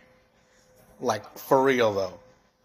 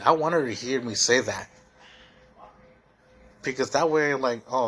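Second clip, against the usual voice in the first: usual speaking voice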